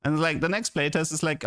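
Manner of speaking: ordinary delivery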